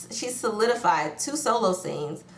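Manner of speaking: ordinary delivery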